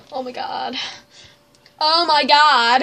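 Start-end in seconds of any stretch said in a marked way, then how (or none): none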